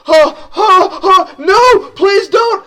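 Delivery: very high, loud gasping plea; pained pleading cry. Acted. Acted cry of the stabbed fighter.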